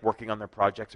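ordinary talk, nothing marked

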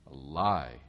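ordinary talk

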